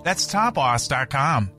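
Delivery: ordinary talk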